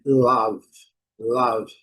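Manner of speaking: love said lav, short open vowel between O and A; love with a short vowel mixing O and A; English love. Shown as a demonstration. Teacher modelling love as lav for Brazilian students. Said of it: "mistura de O com A também".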